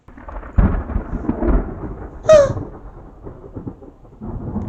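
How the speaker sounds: long high breathy gasp; sharp high baby gasp; startled gasp. Acted.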